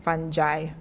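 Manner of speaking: fungi as fun-jai, soft g, final "eye"; own pronunciation of fungi. Shown as a demonstration. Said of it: "I pronounce it".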